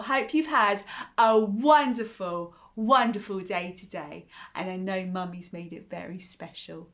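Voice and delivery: ordinary delivery, nothing marked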